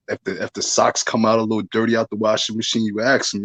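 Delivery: ordinary talk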